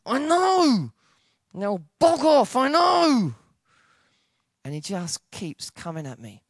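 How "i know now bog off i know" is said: high, loud acted yell; explode, stretching the words out; angry outburst. Preacher telling God to back off, angry yell.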